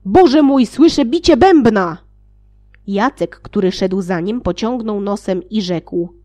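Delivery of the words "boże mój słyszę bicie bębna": loud, high call to his companions; alarmed call. Acted. One of the seven calls to his companions, loud and alarmed. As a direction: cry out that you hear drums. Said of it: "zawołał na towarzyszów".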